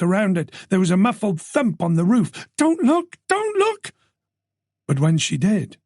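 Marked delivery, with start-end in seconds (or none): 2.5-3.2 high, urgent whispered-to-herself warning; panicked high warning; frightened plea. Acted. Terrified woman in car. 3.3-3.9 even higher, strained repeat of the plea; rising panic. Acted. Terrified woman in car.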